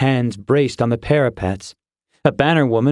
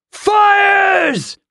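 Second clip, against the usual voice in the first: high, hard battle shout; screamed command; 5 dB louder than usual. A narrator's reading. Captain ordering fires as beasts charge, screamed iron command. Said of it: "Talmir screamed, iron in his voice".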